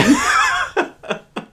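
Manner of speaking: shrieking loud laugh; hearing the Sean Bean joke, shrieking with laughter; loud high laugh. Real, not acted. Big laugh.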